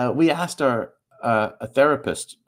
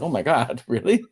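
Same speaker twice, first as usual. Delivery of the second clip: high, laughing "really?" in disbelief; 4 semitones higher than usual. Retold or imitated. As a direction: gasp it out through a laugh, half amused, half shocked. Reacting to the therapist's shocking remark.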